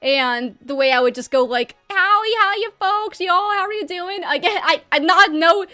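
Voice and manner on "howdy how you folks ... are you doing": higher, playful Southern twang greeting; mock Southern accent. Recalls old YouTube greetings in a mock Southern accent. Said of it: "had a Southern accent".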